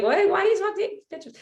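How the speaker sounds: high, fast mock teenage whine; whiny teen boy.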